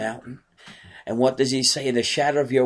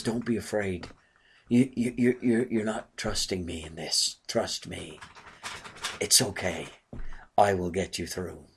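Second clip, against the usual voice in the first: gentle comfort; 4 semitones lower, 8 dB quieter than usual. Acted. God speaking comfort to believers.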